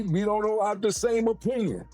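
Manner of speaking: usual speaking voice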